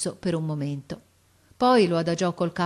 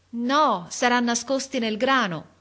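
raised, higher lookout's voice; calling back; 6 semitones higher, 3 dB louder than usual. A narrator's reading. Boy answers officer from tree, calling. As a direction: call the answer down.

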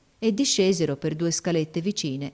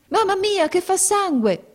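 high, loud, slow panicked cry; a girl crying out in fright at blood; girl screams in terror, much higher and louder; frightened cry; 5 dB louder than usual. Acted. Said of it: "gridò la ragazza".

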